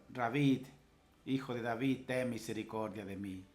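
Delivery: usual speaking voice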